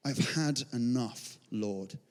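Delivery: very soft, low, slow surrender; weary despair. Acted. Elijah praying that he might die, weary despair. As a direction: give up, slowly and quietly.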